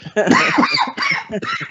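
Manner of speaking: high squealing laugh; shrieking laugh. Real, not acted. Laughing hard at the joke.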